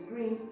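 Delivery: "green" with a curled R after the g; highlighted English R. Shown as a demonstration. Teacher repeating the colour for pupils, curled R. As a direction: model "green" with an English retroflex R. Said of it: "Olha o R mais uma vez".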